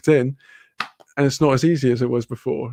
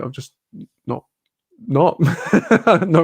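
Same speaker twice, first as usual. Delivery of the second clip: ordinary delivery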